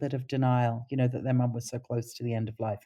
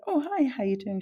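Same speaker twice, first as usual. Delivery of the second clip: bright friendly greeting; 10 semitones higher than usual. Retold or imitated. Greeting a dying patient cheerfully.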